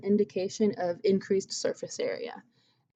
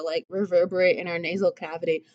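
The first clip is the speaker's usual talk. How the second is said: normal talking voice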